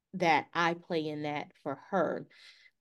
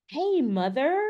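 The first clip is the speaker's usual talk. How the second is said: bouncy greeting; 7 semitones higher, 5 dB louder than usual. Retold or imitated. Bouncy daughter greets mother.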